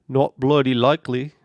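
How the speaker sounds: loud, hammed-up British accent; jokey British put-on voice; bad British accent. Shown as a demonstration. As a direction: attempt a British accent, loudly. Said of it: "attempt a British accent".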